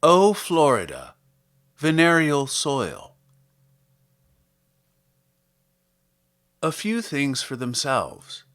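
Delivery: measured, deliberate poem reading with long pauses; poetry reading. Acted. Podcast host reading a Wallace Stevens poem to listeners, measured poetry reading. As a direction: read it slowly, savouring each image.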